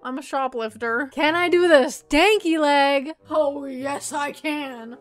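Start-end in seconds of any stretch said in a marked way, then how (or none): 1.1-5.0 hyped goofy shout. Pretend. Hyping herself up before doing the stanky leg. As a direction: announce the dance like a hype man.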